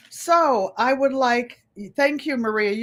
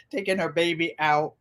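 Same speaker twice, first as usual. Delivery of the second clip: ordinary delivery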